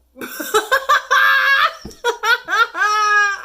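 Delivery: loud, high shrieking laughter; screaming laugh. Real, not acted. Reacting to a funny Tinder bio, a loud shrieking laugh. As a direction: cackle out in disbelief.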